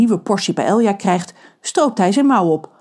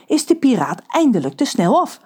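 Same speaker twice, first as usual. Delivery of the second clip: usual speaking voice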